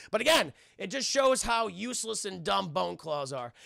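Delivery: normal talking voice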